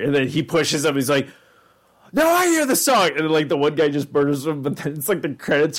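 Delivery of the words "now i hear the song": high, hammed-up cartoon voice; mock crazed character. Retelling a Flash cartoon, high hammed-up character voice. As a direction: squeal it like the crazed cartoon guy.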